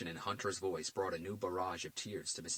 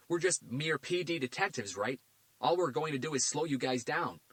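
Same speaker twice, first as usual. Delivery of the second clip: dry, mocking, higher and louder; sarcastic detective; 8 semitones higher, 6 dB louder than usual. A narrator's reading. Garcia mocking the FBI agent, sarcastic. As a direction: needle the agent.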